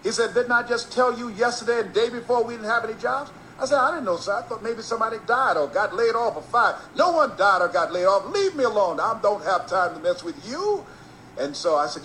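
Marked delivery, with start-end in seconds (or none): 0.3-3.3 exasperated boss. Retold or imitated. 3.8-6.8 innocent pleading. Retold or imitated. Kid innocently persists. 7.0-10.8 higher, louder, shooing him away; angry dismissal. Retold or imitated. Disc jockey tells the kid to leave him alone, loud.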